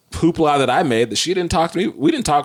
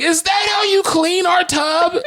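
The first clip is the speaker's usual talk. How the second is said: very high, hammed-up outraged yell; even more mad. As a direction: shriek the question in mock fury. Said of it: "I got even more mad".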